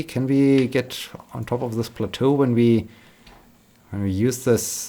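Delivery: normal talking voice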